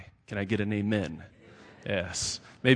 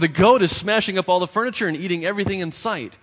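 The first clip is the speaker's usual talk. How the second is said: loud, higher moaning lament; moaning complaint; 7 semitones higher, 7 dB louder, faster than usual. A narrator's reading. Poor man moaning to the rabbi about the goat. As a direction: wail it out in exasperation. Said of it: "He moaned".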